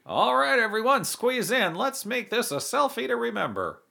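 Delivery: rally everyone into the photo; excited exclaim. A narrator's reading. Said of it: "exclaimed Leo".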